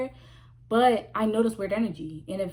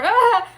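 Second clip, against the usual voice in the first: mock laugh; 11 semitones higher, 9 dB louder than usual. Cackle ending mock taunt.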